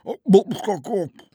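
loud, high cry through a mouthful of pancake; mouth full. A narrator's reading. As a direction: shout with your mouth stuffed. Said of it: "riep met volle mond".

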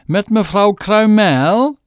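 high, loud, slow, absurdly refined; mother answering the prime minister ridiculously posh; ridiculously posh phone voice. Acted. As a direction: put on the poshest voice you have. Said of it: "nog veel chiquer dan haar gewone telefoonstem".

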